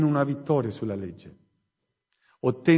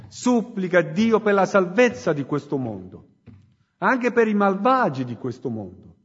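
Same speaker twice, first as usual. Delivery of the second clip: fiery preaching; 3 semitones higher than usual. Acted. Preacher urging the congregation to repent, full-force preaching. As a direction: preach it out to the whole church.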